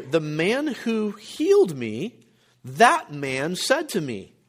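slow, with "healed" lifted and "that man" set apart; drawn-out emphasis on "healed" and "that man"; emphatic stress. Shown as a demonstration. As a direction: lean on "healed", then "that man". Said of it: "So he's emphasizing".

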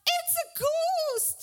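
high, drawn-out wail of a ghost sighting; acted fearful cry. A narrator's reading. Disciples seeing Jesus on the water, hammed-up frightened cry. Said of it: "In their fear, they cried out".

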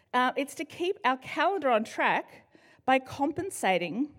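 ordinary delivery

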